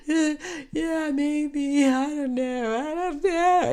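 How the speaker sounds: high, whimpering acted sobs; mock crying. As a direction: answer in a tearful, high-pitched whine, hammed up. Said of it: "I just started crying".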